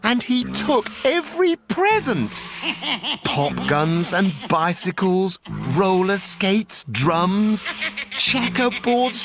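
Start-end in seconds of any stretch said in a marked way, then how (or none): 2.5-3.2 a high, soft, quick cackle of glee; gleeful cackle. A narrator's reading. The Grinch gloating as he takes the presents, a high cackle. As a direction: cackle as you sweep up every present.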